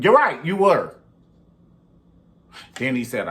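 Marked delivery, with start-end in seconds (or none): 0.0-1.0 loud high snap-back; sarcastic retort. Retold or imitated. Vlogger mocking the husband's lie, loud and high. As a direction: throw it back at him.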